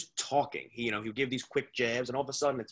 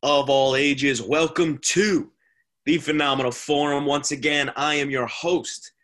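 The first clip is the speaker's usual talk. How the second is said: ringmaster; 4 semitones higher, 10 dB louder than usual. Acted. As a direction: welcome the crowd like a circus ringmaster. Host opens show like announcer.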